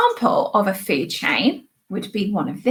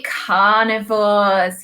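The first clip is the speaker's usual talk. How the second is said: drawn-out call; 2 semitones lower, much slower than usual. Shown as a demonstration. Teacher leading the class to call out, drawn-out. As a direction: stretch carnivores slowly for the class. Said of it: "shout out the screen for me".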